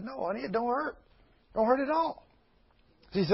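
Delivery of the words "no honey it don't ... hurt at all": soft reassuring wife. Retold or imitated. Wife soothes worried husband.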